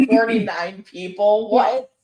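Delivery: slow, laughing incredulous; laughing disbelief. Real, not acted. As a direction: laugh through the question. Laughing disbelief at review count.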